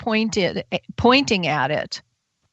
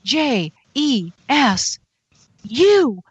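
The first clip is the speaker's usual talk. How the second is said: slow letters climbing higher; rising triumphant spelling; spelling out in relief; 6 semitones higher, slower than usual. Retold or imitated. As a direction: build each letter up toward the shout. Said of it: "And I went".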